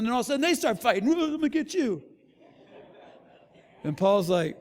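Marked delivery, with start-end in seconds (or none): 1.1-2.0 high, put-on threatening voice; playfully menacing taunt; mock threat. Retelling a fight breaking out.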